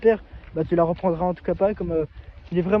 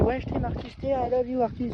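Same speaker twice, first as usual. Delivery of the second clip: higher, soft mock girlfriend coo; lightly voiced girlfriend, higher and hushed; imitating girlfriend; 4 semitones higher, 7 dB quieter than usual. Narrator mocks his ex saying she loves him, higher soft imitation. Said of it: "Elle m'a toujours dit".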